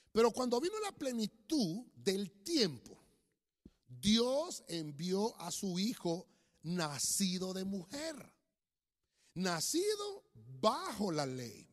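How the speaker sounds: loud, slow, declaimed Bible verse; solemn, weighty scripture reading; preacher reading scripture. Real, not acted. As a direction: proclaim it phrase by phrase with long pauses. Reads scripture aloud to congregation.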